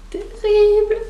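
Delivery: high, loud, drawn-out rave; thrilled. Real, not acted. Fan showing off her new T-shirt, thrilled rave. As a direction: belt it out high with fan delight.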